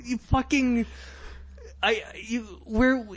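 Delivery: high, exasperated laughing rant; indignant viewer, pitch up; mock outrage. Real, not acted. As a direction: vent at the screen as the audience. Outraged audience member rant.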